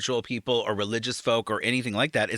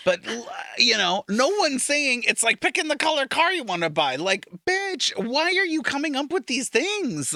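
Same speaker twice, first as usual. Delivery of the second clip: exasperated; 10 semitones higher than usual. Real, not acted. Exasperated rant.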